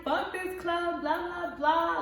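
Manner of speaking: high, mocking drunk rant; drunk friend ranting. Drunk friend thrown out of a club, a high mocking rant. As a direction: dismiss the club in a high mock whine. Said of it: "And she was like".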